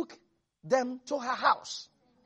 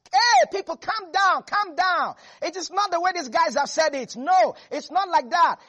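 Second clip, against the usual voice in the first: calling out to crowd; 6 semitones higher, 5 dB louder than usual. Acted. Caleb calming the crowd.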